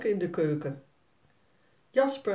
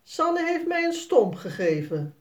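put-on higher whiny tattling boy; klikkend kind; 9 semitones higher than usual. A narrator's reading. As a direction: tattle to mum like a wronged kid. Jasper klikt bij moeder. (hoger klikkend jongetje)

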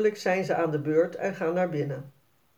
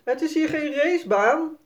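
boos roepen; 8 semitones higher, 4 dB louder than usual. A narrator's reading. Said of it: "kijkt boos en roept".

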